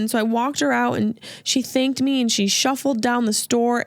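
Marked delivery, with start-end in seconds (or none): none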